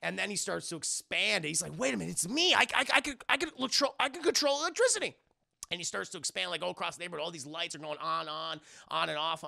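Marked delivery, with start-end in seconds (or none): 1.8-5.1 rising higher and faster with each line; boy realizing he has superpowers, thrilled; excited realization. Retold or imitated. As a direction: stumble into the discovery, getting giddier. Boy discovers his powers.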